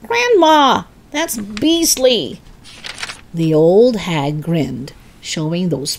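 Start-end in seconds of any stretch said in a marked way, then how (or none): 0.1-2.4 put-on high, appalled child; shocked boy voice. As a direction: recoil in disgust as a boy. George shocked by grandma.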